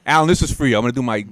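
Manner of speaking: ordinary delivery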